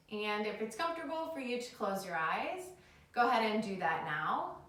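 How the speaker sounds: calm them down into the breath; soothing yoga teacher. Acted.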